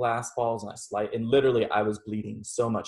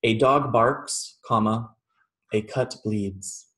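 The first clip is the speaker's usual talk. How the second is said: slow, deliberate mantra; measured reading of a koan; slow koan recital; much slower than usual. Shown as a demonstration. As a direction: lay each word down slowly like a koan. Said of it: "This is your own little Zen koan for you".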